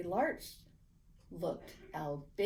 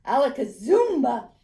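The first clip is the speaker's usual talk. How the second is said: loud magic incantation; 3 semitones higher, 12 dB louder than usual. A narrator's reading. Boy casting his magic word, loud and proud. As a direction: shout the magic word with a flourish.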